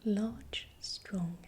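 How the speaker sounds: ordinary talk, nothing marked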